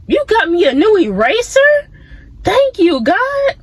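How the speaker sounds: put-on excited kid thanking God for an eraser; mock excited thanks. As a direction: squeal with childlike joy over a small gift. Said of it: "And then you'd be like".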